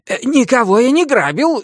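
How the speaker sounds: high, hurried voice of a caught rogue; breathless Menshikov. A narrator's reading. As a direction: protest your innocence, out of breath. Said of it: "развёл руками запыхавшийся Меньшиков". (запыхавшийся Меньшиков)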